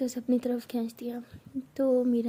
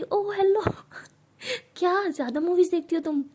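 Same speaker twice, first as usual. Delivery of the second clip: indignant mother; 6 semitones higher, 6 dB louder than usual. Acted. Mother indignant at false accusation.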